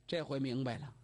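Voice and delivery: plain reading voice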